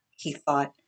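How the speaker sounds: usual speaking voice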